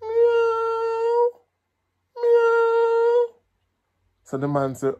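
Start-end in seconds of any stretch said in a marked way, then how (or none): 0.0-3.3 high squeaky meowing; cat meow. Pretend. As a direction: fake a cat to fool him. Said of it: "town country thief say".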